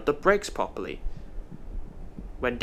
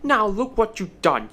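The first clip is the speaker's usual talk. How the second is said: higher-pitched cross scolding; scold, mildly heated; 6 semitones higher, 4 dB louder than usual. Acted. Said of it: "panting with breath and very cross".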